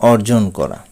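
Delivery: ordinary talk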